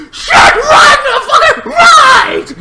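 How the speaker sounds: very high, loud screaming; panicked shouting. Acted. Siblings fleeing the creepy guy, panicked shout. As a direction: scream frantic orders to flee.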